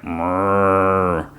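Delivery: a hummed mysterious "mrrr"; a distant creature noise from the farm. Retold or imitated.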